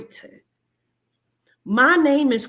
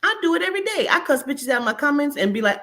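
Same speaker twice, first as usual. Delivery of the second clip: normal talking voice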